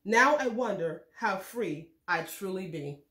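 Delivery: ordinary delivery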